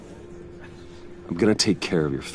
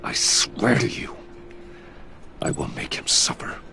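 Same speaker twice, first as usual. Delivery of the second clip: menacing whisper; 2 semitones lower than usual. Acted. Vowing revenge for dead friend.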